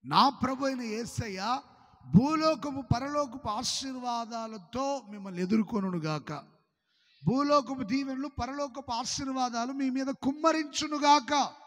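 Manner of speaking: loud, lifted blessing pronounced over all; benediction. Acted. Pastor blessing the congregation, loud preaching benediction. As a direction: pour the blessing out like a prayer.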